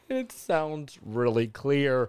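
very slow, deliberate "really clear"; careful enunciation. Real, not acted. Hoping Junior hears him well on playback, slow careful enunciation. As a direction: stretch out "really clear", each word distinct. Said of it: "speaking clearly".

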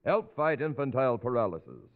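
ordinary talk

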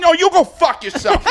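high, loud comic outburst; mock arguing shout. Comic re-enacting a late-show argument with a guy, mock angry yell. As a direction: yell it as a drunken heckler comeback, played for laughs. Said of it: "I'm arguing with the guy".

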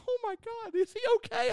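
panicked mother checking on the man; imitating mom's alarmed voice, half joking; worried mom. Mom panics after the fight.

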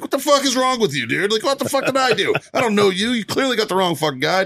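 worked-up, high-voiced protest; yelling. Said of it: "I'm yelling at this fucking unconscious kid".

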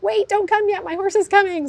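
high, loud frantic call to hold the wire; yelling for the finish line to wait; urgent yell. Retold or imitated. Jockey's trainer yelling at the wire to wait for her horse, high and loud. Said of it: "certain races where you're like".

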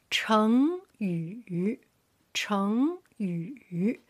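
成语 with 成 rising, 语 dipping; long lift on 成, dipping 语; second tone, third tone. Shown as a demonstration. Teacher drilling tones for learners, 成 rising, 语 dipping. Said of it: "The third line".

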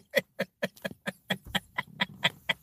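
long, quiet wheezing laugh; helpless laughter. Real, not acted. Cracking up over Forrest loving Jenny, wheezy laughter. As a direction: crack up until the breath runs out.

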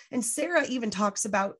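normal talking voice

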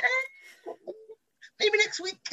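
very high, hesitant little squeak; mock-timid high-pitched excuse; reluctant dodge. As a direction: imitate a sheepish brush-off, high and laughing. Acts out her shy refusal.

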